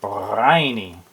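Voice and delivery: Briny with a rolled R, said low; Briny, low and with the R rolled hard; rolled R pirate. Shown as a demonstration. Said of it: "Try rolling the R to really sound like a pirate".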